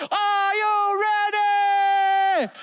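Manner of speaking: high, long drawn-out teacher's yell; hype shout. Retold or imitated. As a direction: stretch it out like a spin instructor rousing the class. Spin teacher hypes up the class.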